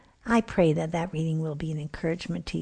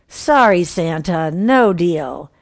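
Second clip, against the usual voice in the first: louder, firm no; frowning refusal; 8 dB louder, slower than usual. Acted. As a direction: shut Santa down hard. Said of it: "The mom frowned and said".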